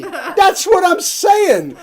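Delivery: laughing, drawn-out exasperated shout; cracking up mid-argument, yelling it slowly. Acted. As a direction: blurt it out slowly, half laughing in frustration. Exasperated comedy double act.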